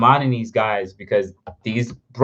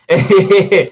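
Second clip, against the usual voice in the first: high, loud giggle; laughing; 7 semitones higher, 9 dB louder than usual. Real, not acted. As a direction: burst out laughing at yourself. Laughs at own bad texting.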